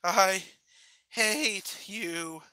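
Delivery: creaky, drawn-out words; cracked, pause-broken delivery. A narrator's reading. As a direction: croak it out haltingly, voice unused. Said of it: "her voice cracked from underuse".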